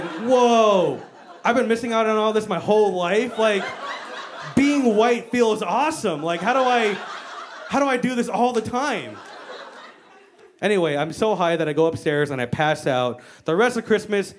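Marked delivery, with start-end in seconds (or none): 0.0-9.2 amazed elation. Retold or imitated. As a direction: gush in mock awe, pitched up.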